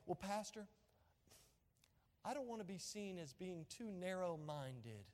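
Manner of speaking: softly voiced, lightly acted hesitant churchgoer; reluctant churchgoer. Churchgoer hedging to the pastor about narrow-mindedness, mildly imitated reluctance. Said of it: "pessimism is seen in our, our current culture like this".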